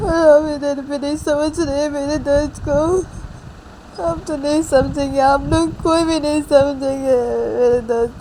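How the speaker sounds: long wailing lament, words dragged out; acted crying. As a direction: wail that nobody understands your pain. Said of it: "रोना बंद करो". (बिलख-बिलख कर रोने का अभिनय)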